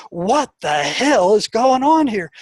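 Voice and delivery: exasperated outburst. Retold or imitated. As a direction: demand it slowly, baffled and rattled. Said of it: "and I'm like".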